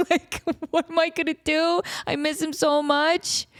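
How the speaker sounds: high, drawn-out pretend wail; hammed-up mourning for a lost pet; mock grieving. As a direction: whine it tearfully, half joking. Imagines mourning cat in memorial episode.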